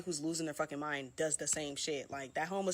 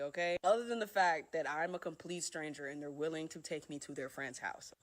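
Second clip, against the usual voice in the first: ordinary delivery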